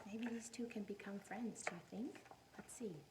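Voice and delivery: hushed whisper to the class. Real, not acted. Teacher whispering to listening children. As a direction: lean in and whisper the question.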